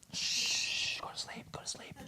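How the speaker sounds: hushed, menacing lullaby whisper; barely audible playful whisper; mock-soothing whisper. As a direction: shush them and whisper them under. Pretends choking screamers out.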